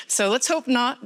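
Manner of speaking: usual speaking voice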